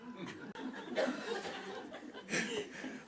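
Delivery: high airy burst of laughter; delighted laugh. Real, not acted. Teacher laughing at the coma story, breathy laughter.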